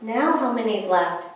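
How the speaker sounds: ordinary delivery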